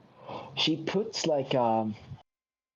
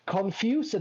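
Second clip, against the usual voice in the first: higher, accented mock announcement; imitated loud announcement; 8 semitones higher than usual. Older hairdresser so everyone can hear, mock-loud announcement. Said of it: "she just says loudly".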